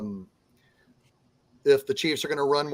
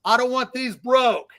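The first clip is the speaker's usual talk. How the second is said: Roger Goodell; 5 semitones higher than usual. Acted. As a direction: lay down the ruling in a raised, hammed-up voice. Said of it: "Roger Goodell said".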